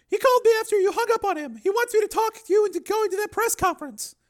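very high, loud woman's voice; woman character, urging. Acted. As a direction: press him in a high voice.

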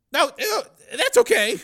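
high, loud nervous backtracking; anxious hasty reply. Acted. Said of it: "Shelley replied quickly".

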